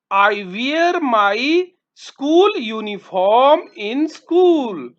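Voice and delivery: very slow, clear sentence; slow model answer. Shown as a demonstration. Teacher dictating an answer to pupils, very slow. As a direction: dictate it slowly to the class. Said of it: "teacher model answer".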